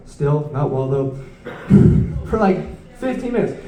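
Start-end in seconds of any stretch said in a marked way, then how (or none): 0.1-1.2 low, slow, softer report; failing searcher. Acted. Man documenting his own failure in real time, low and slow. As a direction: drag out yet another failure, low and subdued. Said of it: "a man documenting his own failure".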